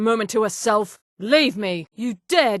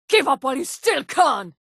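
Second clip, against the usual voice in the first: usual speaking voice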